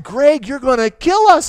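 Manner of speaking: loud, high, slow frightened shout; scared yell. Retold or imitated. Pastor yelling at Greg on the speeding boat, scared shout. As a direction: yell over the engine in fear.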